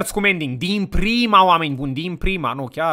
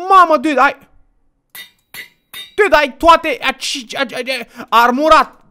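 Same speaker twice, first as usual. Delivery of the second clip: high, excited stammering outburst; amazed outburst; 8 semitones higher, 5 dB louder than usual. Real, not acted. Player amazed at a partner's armour, excited and high. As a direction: blurt it out high, tripping over the words.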